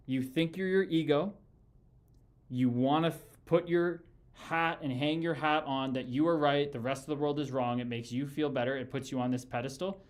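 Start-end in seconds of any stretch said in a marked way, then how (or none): none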